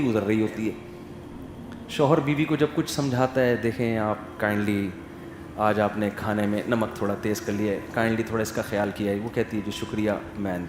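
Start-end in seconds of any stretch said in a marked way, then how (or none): 3.6-9.2 soft, low, gentle husband's voice; very gently. Retold or imitated. Husband gently correcting wife about salty food, soft and calm. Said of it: "بہت آرام سے". 9.6-10.6 soft, low thank-you; gently. Retold or imitated. Wife answering husband politely, soft and low. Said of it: "بہت آرام سے".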